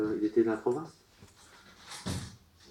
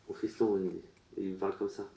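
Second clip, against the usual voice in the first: normal talking voice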